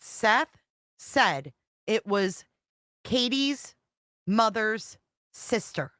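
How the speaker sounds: spelled out slowly, word group by group; slow, deliberate. Real, not acted. Streamer spelling out Seth's claim for viewers, slow and deliberate. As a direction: lay it out slowly with pauses between phrases.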